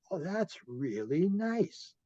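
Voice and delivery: put-on a small girl marvelling at something; little girl. Little girl talking to tree.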